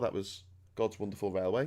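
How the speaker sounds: usual speaking voice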